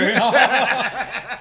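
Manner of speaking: a burst of high, loud laughter. Real, not acted. Laughing at his own story with friends, loud. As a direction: crack up at the memory.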